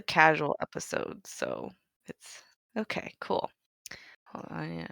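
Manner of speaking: groggy just-woke-up voice; rough, unused morning voice. Real, not acted. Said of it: "I got my morning voice right now".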